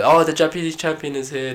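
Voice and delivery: high-pitched mock fawning; impressed classmates. Fan mimicking classmates at a reunion, excited. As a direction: gush like an excited old classmate.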